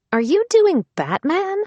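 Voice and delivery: high rising disbelieving question; incredulous. Acted. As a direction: ask in sheer disbelief.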